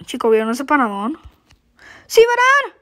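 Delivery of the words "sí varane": excited cheer. Real, not acted. Cheering a play.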